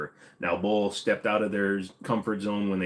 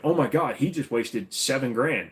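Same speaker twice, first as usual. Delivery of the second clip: higher, louder mock disbelief; shocked viewer; 5 semitones higher, 4 dB louder than usual. Streamer voicing what viewers think, mock shock. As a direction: blurt it like an appalled onlooker. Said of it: "and you're thinking".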